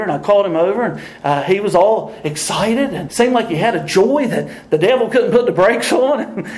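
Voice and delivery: usual speaking voice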